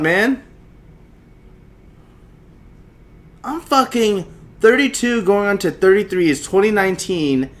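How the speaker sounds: loud, high-pitched rant; real exasperated outburst. Real, not acted. Host fed up with his phone interrupting again, exasperated. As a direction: raise the voice in frustrated disbelief.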